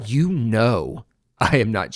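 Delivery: a slow insistent appeal to a friend; earnest plea. Retold or imitated. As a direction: make her believe you, slowly and firmly. Pleading with coworker.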